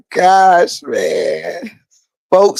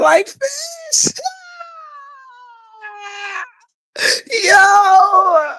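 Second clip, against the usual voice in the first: crying laughing; much slower than usual. Real, not acted. Laughing at Miss Hurd's line.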